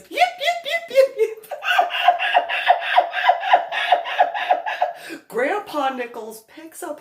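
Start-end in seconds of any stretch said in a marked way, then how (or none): 0.0-1.5 high, loud little-dog yips through laughter; yipping chihuahua. Retold or imitated. High yips for the chihuahua Julio. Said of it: "Julio then pipes up and goes". 1.5-5.2 high, loud breathless laugh; high wheezing laugh. Real, not acted. Loud high laugh after doing the dog yips.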